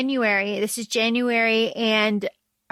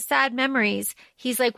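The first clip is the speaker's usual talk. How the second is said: normal talking voice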